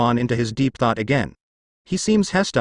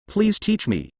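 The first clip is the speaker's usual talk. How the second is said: higher pleading request; begging disciple; 7 semitones higher, slower than usual. Acted. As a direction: plead like a kneeling student. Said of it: "kneeled to me".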